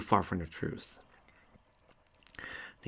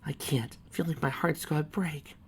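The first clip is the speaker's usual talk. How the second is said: tearful; 6 semitones higher, 4 dB quieter than usual. Acted. Choked with emotion.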